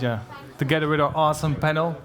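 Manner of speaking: usual speaking voice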